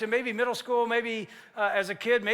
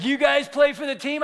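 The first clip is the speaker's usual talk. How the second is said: higher, louder taunting shout; cocky challenge; 5 semitones higher, 6 dB louder than usual. Retold or imitated. Cocky kid taunting the Lions players, shouted. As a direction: call them out, cocky. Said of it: "this cocky kid comes up".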